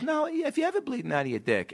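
high-pitched mock interviewer voice; high celebrity impression; Barbara Walters. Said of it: "Barbara Walters goes".

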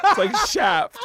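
said through laughter; laughing. Real, not acted. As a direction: laugh out the punchline. Joke delivered while laughing.

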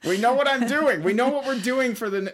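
high, loud outburst to a partner; excited exclamation. Real, not acted. Joking with wife about their future.

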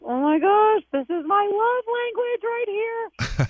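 high, slowed-down thrilled gush, drawn out at the end; delighted gush. Retold or imitated. Caller replays her thrill at her boyfriend's repair, high and drawn out. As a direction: swoon over it like it's the best gift ever. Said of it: "I was like".

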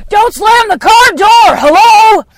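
high, loud yelled order; dad mid-yell. Retold or imitated. Imitating dad yelling at kids then picking up the phone, shouting. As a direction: bellow it, keep the same shout for the greeting. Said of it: "yelling at someone".

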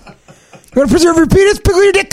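very high pitched pitch; high squeaky voice. Shown as a demonstration. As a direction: push it way up high. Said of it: "Come on".

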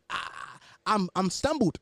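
usual speaking voice

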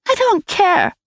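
put-on high, loud child's outburst; a girl yelling at her stepmother; cried out. A narrator's reading. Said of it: "Gudrun cried".

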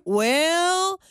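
high, long stretched well; imitated catchphrase well. Co-host imitating her colleague's habit, drawn-out well. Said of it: "Because you always do the".

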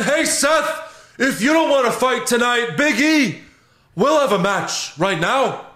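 high-pitched hammed-up wrestler challenge; Kevin Owens. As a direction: call out a rival in a high wrestler voice. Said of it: "tag Kevin Owens in it".

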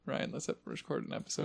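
soft unvoiced whisper to a friend. Real, not acted. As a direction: whisper it under your breath. Nudging co-host to start recording.

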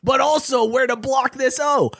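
rising, half-sung mock shout; mock hype shout. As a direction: oversell a tic-tac-toe block like a pump-up speech. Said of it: "looking at somebody and being like".

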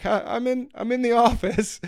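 high, trying to sound normal while laughing; holding back laughter. Real, not acted. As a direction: fight the giggle and fail. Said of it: "I had to compose myself".

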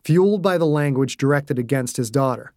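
ordinary delivery

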